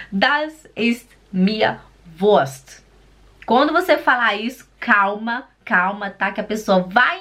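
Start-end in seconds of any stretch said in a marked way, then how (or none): 0.1-2.8 very slow, word by word; slow teaching delivery, spaced words; slow model. Shown as a demonstration. Teacher showing learners the German phrase, slowly word by word.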